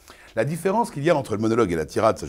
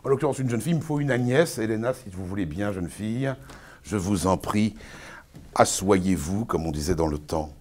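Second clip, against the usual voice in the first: ordinary delivery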